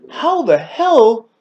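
high, slow disbelieving quote; can't believe he remembers; mock amazement; incredulous. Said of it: "I'm like".

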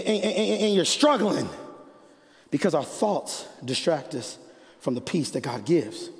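ordinary delivery

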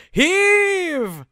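high, drawn-out bellow; commanding shout. Acted. Captain ordering the crew to pull the rope, commanding shout. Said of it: "I just shout".

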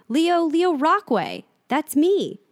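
high, loud and slow, every word distinct; a boy bursting out with his own name; forceful and clear. Acted. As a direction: declare your name at last. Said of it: "with sudden force and great clarity".

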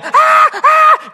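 high, loud mock shriek of alarm; imitated scream. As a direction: shriek like the wife crying for help. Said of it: "ella empieza a gritar". (grito agudo de mujer, imitado y fuerte)

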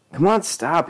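high-pitched mock command. A narrator's reading. Johnny breaks up the fight, high-pitched command. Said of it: "he commanded".

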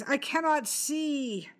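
high, slow cry of panic; terrible cry. A narrator's reading. Blinded servant crying out to Anna, high and slow. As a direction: wail it out, blinded. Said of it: "A terrible cry rent the air".

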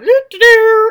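loud, high car sound effect; sing-song vehicle noise, rising sharply; imitated driving noise. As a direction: toot out a playful driving sound.

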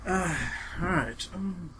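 ordinary talk, nothing marked

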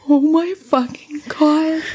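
high retold gasp of dread; horrified realization. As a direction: imitate dawning horror, drawn out. Retold horror at the bunny.

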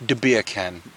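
understated Jamaican accent demo. Shown as a demonstration. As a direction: coach it with a light Jamaican lilt. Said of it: "say in a Jamaican accent".

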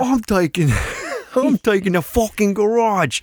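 high, hammed-up British tirade; fake British accent. Cousin bragging about taking the garage, in fake British accent. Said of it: "an American doing a British accent".

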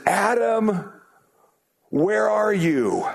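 high, drawn-out searching call; God calling. Acted. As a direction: call out slowly to someone lost, pitched well up. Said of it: "he calls his lost people back".

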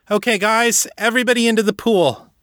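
high, quick, keyed-up call to the team; rally them, pitched high and fast; eager call. A narrator's reading. Kate urging her team into the water, high and quick.

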